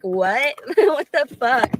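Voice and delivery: incredulous laugh through the words; laughing disbelief. Retold or imitated. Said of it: "I'm like".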